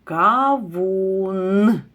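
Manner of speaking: "Кавун" loud, with the final sound held; clear demo word. Shown as a demonstration. Teacher to pupils finding the last sound, "Кавун" said loudly. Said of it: "Слухайте слова".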